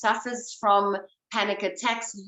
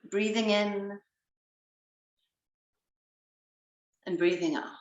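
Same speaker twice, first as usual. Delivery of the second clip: guided breathing. Acted. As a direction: gently guide each breath.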